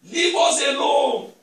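loud, slow, high cry to be left alone; shouted plea. Retold or imitated. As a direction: shout it out as the possessed men begging. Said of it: "They shouted when they saw him".

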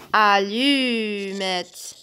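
Allumette drawn out slowly, each syllable clear; slow syllable demo. Shown as a demonstration. Teacher slowly breaking Allumette into syllables for children. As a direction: stretch Allumette so children hear lu.

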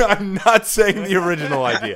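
high, loud protest through laughter; laughing refusal. Real, not acted. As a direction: refuse loudly while cracking up. Laughs, refusing to reveal idea.

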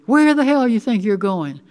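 loud, high-pitched yell; yelling after someone. A narrator's reading. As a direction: call angrily after someone walking away. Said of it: "She yelled after me".

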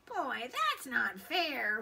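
sulky little creature's complaint; whining. A narrator's reading. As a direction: whine about unfairness. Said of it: "whined the pigtail".

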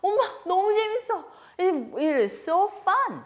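put-on high, loud kid voice; excited child. Child answering mother about English class, high excited voice. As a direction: gush like a delighted child. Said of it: "아이의 반응이 또 참 궁금합니다".

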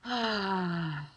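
long audible sigh out; exhaling sigh. Shown as a demonstration. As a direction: breathe out with a sigh. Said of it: "Exhale with a sigh".

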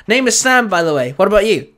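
lightly voiced excited kid; eager young trainer. A narrator's reading.

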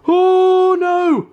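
high loud scream of fright; terrified horror victim. Real, not acted. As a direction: shriek as it comes at you. Bee flies at her.

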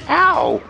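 high, sudden "ow"; sharp cry on taking a hit; loud pained yelp. Real, not acted. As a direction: yelp as if just struck. Player gets hit in game.

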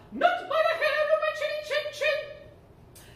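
a tiny pig squealing back defiantly; giggly high pig refusing the wolf; little pig voice. Acted. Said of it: "playing the role of the pigs".

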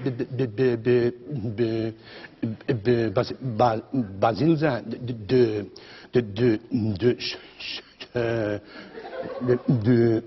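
halting, stammering on every first syllable; stuttering imitation. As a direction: imitate a stutterer tripping over be- and de-. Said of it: "Louietje stotterde een beetje".

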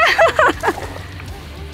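breathy laugh at yourself; embarrassed laugh. Real, not acted. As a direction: laugh it off after a slip. Laughs after slipping.

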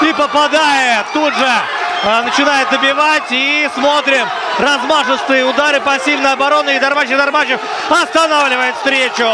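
excited play-by-play. Real, not acted. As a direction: call the knockout as it happens. Excited knockout commentary.

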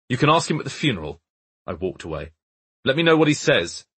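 high, loud curt retort; loud, annoyed exit line; parting shot. A narrator's reading. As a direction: fling it over your shoulder. Walking off after the quarrel.

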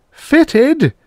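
a very high, loud, horrified scream; a very high, loud scream of horror. A narrator's reading. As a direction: shriek the word in disbelief. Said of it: "Sherwood screamed".

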